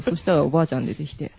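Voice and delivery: ordinary talk, nothing marked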